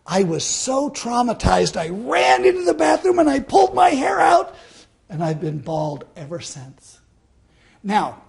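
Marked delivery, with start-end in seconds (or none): 1.8-4.6 frantic. Acted. Comic climax of trauma story.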